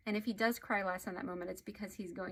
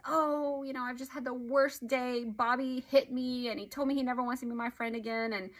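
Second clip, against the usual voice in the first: higher, louder mock wail of a child; acted upset child; 4 semitones higher, 5 dB louder than usual. Son Johnny comes home to his parent, mock upset. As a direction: lightly play a distressed kid pouring it out. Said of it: "he's just crying and he's crying".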